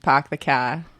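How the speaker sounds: "Park the car" as "pahk the cah" without R; Boston accent. Shown as a demonstration. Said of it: "They don't say the R".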